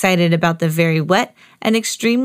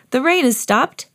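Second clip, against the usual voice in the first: put-on higher child announcing good news; eager little pig; excited cry; 6 semitones higher than usual. A narrator's reading. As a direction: exclaim with lifted pitch. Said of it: "cried Peppa".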